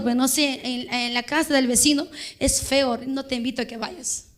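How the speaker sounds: subdued, offhand quoted reply; evasive quiet reply. Retold or imitated. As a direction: answer mamá under the volume of the story. Retells evasive answers to mother.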